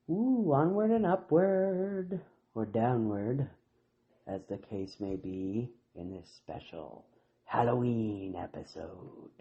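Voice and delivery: deep, slow spooky announcer voice; low haunting voice. Pretend. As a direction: drop it low and eerie. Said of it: "Do I sound spooky or just lame?".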